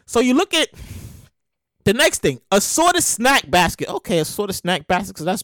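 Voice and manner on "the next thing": ordinary delivery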